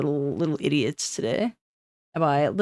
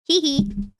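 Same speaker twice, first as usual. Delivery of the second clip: high giggle; 9 semitones higher than usual. Pretend. Teasing giggle.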